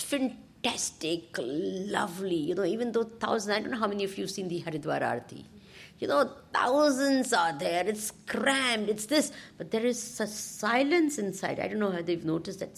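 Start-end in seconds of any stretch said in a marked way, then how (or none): none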